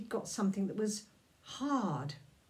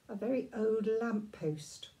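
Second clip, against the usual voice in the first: ordinary delivery, nothing marked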